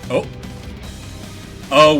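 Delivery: ordinary talk, nothing marked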